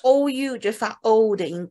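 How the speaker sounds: slowly explaining then modelling court; walk the student through the court vowel slowly; slow explanation. Shown as a demonstration.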